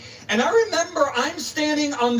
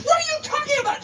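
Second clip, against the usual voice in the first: much higher, near-scream; he can't believe what she hears, so she shrieks it; incredulous near-scream. Retold or imitated. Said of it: "literally almost screaming at me".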